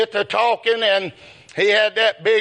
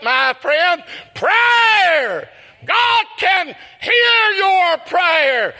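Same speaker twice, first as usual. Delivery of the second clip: preaching at full force; 7 semitones higher, slower than usual. Acted. Preacher shouting to his flock about prayer, full preaching roar. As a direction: cry it out like a revival preacher.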